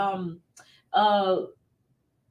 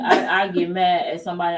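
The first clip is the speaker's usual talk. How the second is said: ordinary delivery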